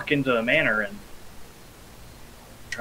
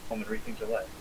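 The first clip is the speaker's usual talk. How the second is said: soft breathy Jedi mind trick; quiet Obi-Wan line; 9 dB quieter, faster than usual. Retold or imitated. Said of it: "or whatever Obi-Wan says".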